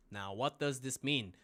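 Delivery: ordinary talk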